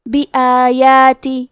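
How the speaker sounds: بی آیاتی slow, with both alifs held for the same length; بی آیاتی with two evenly stretched long vowels; بی آیاتی, both madd stretched equally. Shown as a demonstration. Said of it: "ہم یہ دونوں مد کو برابر سے ادا کریں گے".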